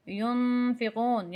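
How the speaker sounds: slow ینفقون with the first n hummed in the nose; drawn-out nasalized n in ینفقون; ghunnah. Shown as a demonstration. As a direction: hold a nasal n in ینفقون before the f. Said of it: "آواز ناک میں جائے گی".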